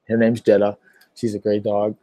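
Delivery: ordinary delivery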